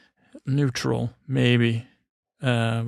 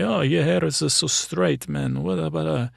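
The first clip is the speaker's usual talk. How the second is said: attempted Italian barber accent, higher-pitched; failed Italian accent; 5 semitones higher than usual. Barber remarking on a client's straight hair, half-done Italian accent. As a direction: have a rough go at an Italian accent. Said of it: "I can't even do a, a Italian accent".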